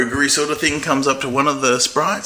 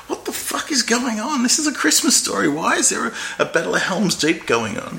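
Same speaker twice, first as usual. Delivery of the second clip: higher, baffled what-is-happening; incredulous disbelief; 7 semitones higher than usual. Retold or imitated. Retelling a baffling Christmas film, incredulous. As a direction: throw up your hands in mock outrage. Retelling own bewildered reaction.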